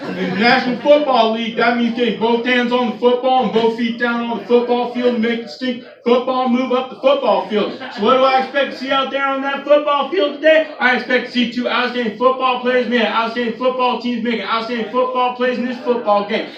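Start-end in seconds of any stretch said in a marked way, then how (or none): none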